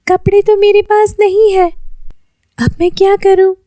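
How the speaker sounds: put-on Lily fretting she has no clothes, high girl voice; worried girl voice. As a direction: wonder helplessly what to do.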